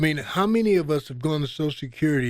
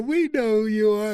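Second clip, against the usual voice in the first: imitating dismissive staff; 8 semitones higher, 4 dB louder than usual. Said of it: "And they go".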